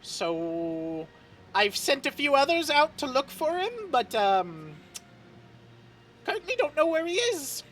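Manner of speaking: put-on high, flustered old butler; fussy servant voice.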